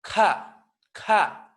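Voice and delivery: loud, throaty puffed K in "ka"; aspirated postvelar. Shown as a demonstration.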